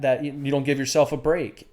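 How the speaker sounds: normal talking voice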